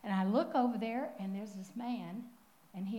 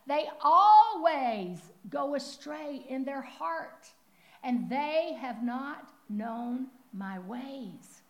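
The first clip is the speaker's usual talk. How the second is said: solemn loud reading; 2 semitones higher, 8 dB louder, much slower than usual. Acted. God's angry words in scripture.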